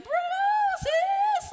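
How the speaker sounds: drawn-out high mock whoop; exaggerated over-familiar greeting. Mocking overly casual churchgoers greeting, exaggerated. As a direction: stretch it into a long, loud, high call. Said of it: "swing the pendulum the other way".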